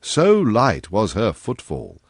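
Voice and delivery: ordinary delivery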